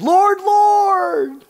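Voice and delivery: very high, loud mock cry; hammed-up, emphatic pious wail; excited. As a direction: draw it out like an overexcited worshipper. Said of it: "They're excited".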